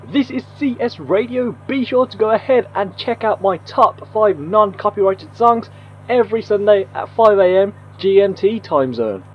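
muffled AM radio voice; station announcement through a radio filter; radio effect. Shown as a demonstration. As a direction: sound like you're on the radio. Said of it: "how simple it is to do a radio effect".

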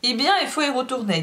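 quick, dry retort; curt, nasty. Acted. Madame rises and sends the servant back, curt and nasty. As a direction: dismiss her meanly and fast. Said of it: "sèchement, méchamment".

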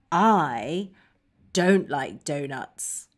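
low, soft and downcast; deep, subdued refusal; glum dislike. Shown as a demonstration. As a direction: say it low and let down, word by word. Modelling dislike of donuts.

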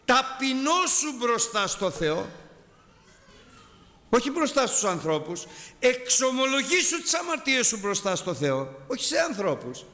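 high, slow exhortation to humble yourself; impassioned sermon call; preaching at full force. Acted. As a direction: plead with the flock to confess before God. Exhorting congregation, raised pitch.

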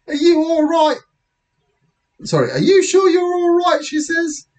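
very high-pitched British lady officer; British policewoman. Acted. Policewoman checking on boy, high British voice. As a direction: put on a high British woman's voice. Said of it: "the police officer's British, but she is now".

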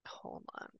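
a hushed whisper to herself. Real, not acted. Medium whispering while sensing spirits. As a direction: whisper it as if listening hard.

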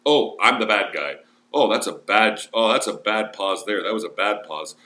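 ordinary delivery

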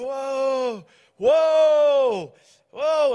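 loud, high, drawn-out whoas; imitated horse whisperer. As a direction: call to horses in long falling swoops. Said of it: "So he's going".

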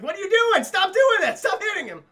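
high, loud scolding yell; mock outraged shout. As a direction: yell frantic protests, hammed up. Acts Reynolds protesting the punching.

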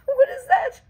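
high, loud burst of laughter on the words; laughing squeal. Real, not acted. Vlogger laughing at her own bad reading, squealing.

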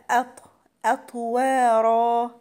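"أطوارا" with an echoing bounce on ط; qalqalah on ط. Shown as a demonstration. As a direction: bounce the ط of "أطوارا", then draw out the vowels. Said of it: "الطاء مقلقلة عشان هي ساكنة".

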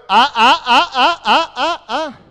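loud, high staccato yelps; repeated yelled "ah". Real, not acted. Coach interrupting players' drill, loud high repeated yells. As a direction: yell short bursts, rising in pitch.

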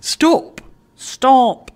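high, loud urgent stop; sharp cry. Acted. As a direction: call out sharply to halt him. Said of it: "echoed Mister Fish".

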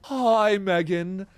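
lightly mimicked British boy's greeting; imitating the boy's greeting. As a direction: parrot the kid's cheery hello. Echoes the boy's greeting from the clip.

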